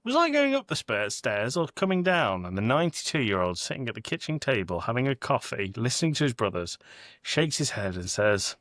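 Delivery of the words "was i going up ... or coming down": put-on high, quavering old brother calling down the stairs; lightly voiced confused old man; an old brother's high call from halfway up the stairs. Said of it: "and he yells".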